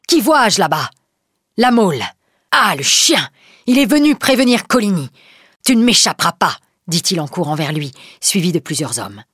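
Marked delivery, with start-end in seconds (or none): 0.1-6.6 louder, higher furious cry; angry exclamation. Acted. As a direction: spot the enemy and roar at him. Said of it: "s'écrie tout à coup Coconnas".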